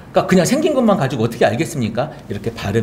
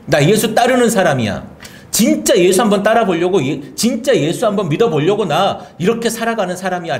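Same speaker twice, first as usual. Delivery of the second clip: confident declaration at raised pitch; bold declaration; 7 semitones higher than usual. Acted. Answering whether one believes in Jesus, bold and unashamed. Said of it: "내가 당당하게".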